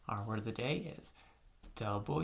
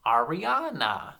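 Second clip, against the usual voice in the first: Ariana slow and loud, in one connected sweep; name said whole; 9 dB louder, slower than usual. Shown as a demonstration. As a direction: present Ariana clearly as one word. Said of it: "say this name with me".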